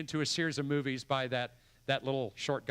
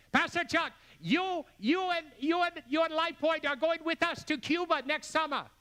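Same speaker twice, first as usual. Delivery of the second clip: much higher, stammering with excitement; excited Pastor Yuan; 11 semitones higher than usual. Retold or imitated. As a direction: gush and stumble like an overexcited friend. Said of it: "He's always excited".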